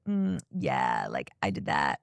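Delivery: deep, low smug mumble; smug guy impression. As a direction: drop the voice way down and smirk through it. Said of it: "he's like".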